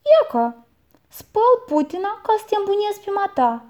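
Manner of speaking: high, quick sly answer; boy explains himself to the aunt, quick and sly. A narrator's reading. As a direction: rattle off the cheeky excuse.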